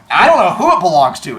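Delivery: loudly disown it, playing innocent; mock denial. Imagines disowning his car.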